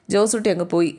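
ordinary delivery

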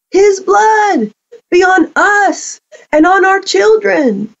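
high, declaimed cry of the crowd; crowd calling out. Acted. The people answer Pilate as he washes his hands, acted crowd cry. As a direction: pitch it well up like a mob's answer, without shouting. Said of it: "the crowd got it right".

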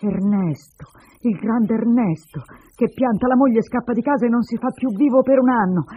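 jeer, then build into an angry reproach; sarcastic. Acted. Woman reproaches runaway husband, sarcastic and bitter. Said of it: "Lei riprese sarcastica".

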